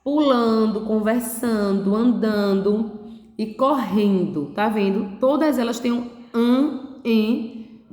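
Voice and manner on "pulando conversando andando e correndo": words read out slowly, one at a time; slow, careful reading of "comendo", "conversando", "correndo". Shown as a demonstration. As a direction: spell out the list slowly for children.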